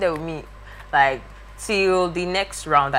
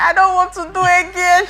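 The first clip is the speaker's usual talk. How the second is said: high, loud whiny protest; mock whine; 10 dB louder than usual. Tired camper protesting early morning exercise, high loud whine. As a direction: whine like a kid refusing exercise. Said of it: "I was crying".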